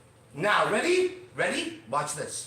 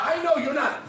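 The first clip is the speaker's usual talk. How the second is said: ordinary talk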